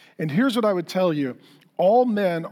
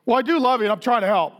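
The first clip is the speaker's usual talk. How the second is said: imitated defensive comeback, pitched higher; 5 semitones higher, 3 dB louder, faster than usual. Husband answering his wife's criticism, imitated defensive protest. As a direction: protest your good intentions, mock defensive. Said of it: "I got defensive, and I was like".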